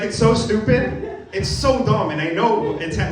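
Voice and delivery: ordinary talk, nothing marked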